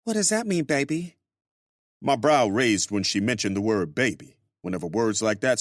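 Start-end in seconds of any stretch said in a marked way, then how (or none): 0.1-1.2 high female voice, playful question rising; high-pitched woman teasing her lover; teasing Grace. Acted. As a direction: bait him sweetly.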